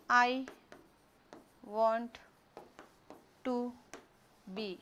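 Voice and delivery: one word at a time, long pauses; word-by-word dictation. Shown as a demonstration. Teacher dictating an English question to the class, word by word. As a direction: dictate slowly, leaving gaps to write.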